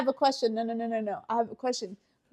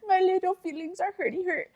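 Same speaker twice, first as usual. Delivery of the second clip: high, babyish mock-crying; mock hurt feelings; 6 semitones higher than usual. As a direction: tease him with a pouty, whimpering baby voice. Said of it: "he's all like".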